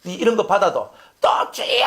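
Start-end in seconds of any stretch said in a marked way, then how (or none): 1.5-1.8 strained, drawn-out shout; throat-tearing shout to God; hoarse prayer cry. Acted. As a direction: cry out till the throat gives out. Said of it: "소리를 지르며".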